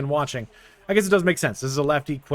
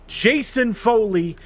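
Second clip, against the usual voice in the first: higher, louder exasperated complaint, stretching the stupid manager; real annoyed outburst, pitched up and loud; frustrated rant; 8 semitones higher, 5 dB louder than usual. Real, not acted. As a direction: vent at the manager, voice climbing. Blaming manager after home runs.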